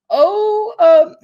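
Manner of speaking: very high, loud stammer; mock flustered squeak. Vlogger mocking the tweet as a nervous squeak, very high.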